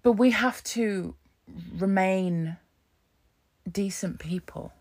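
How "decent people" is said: whispered softly to land the point. Real, not acted. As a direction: breathe the last words. Soft close of point.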